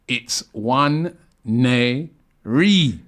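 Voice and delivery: "one ne re" syllable by syllable, very slow; slow spelled out. Shown as a demonstration. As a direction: split "one ne re" into separate slow beats. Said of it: "Robbie and friends".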